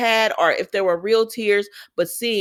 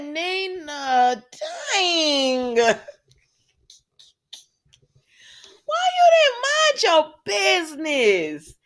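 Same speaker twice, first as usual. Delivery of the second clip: exasperated cry; 9 semitones higher, much slower than usual. Retold or imitated. Retelling her reaction to Nina.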